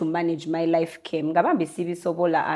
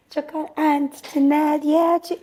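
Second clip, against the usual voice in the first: higher, slow mock nag; imitated nagging relative; 8 semitones higher, slower than usual. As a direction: lightly put on a pestering relative. Mimicking someone nagging about food.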